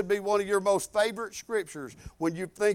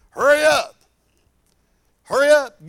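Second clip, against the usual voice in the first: loud, raised call to hurry; impatient urging; 5 semitones higher, 9 dB louder than usual. Acted. Preacher acting out urging a young minister, impatient call. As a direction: call it out sharply, pushing someone along.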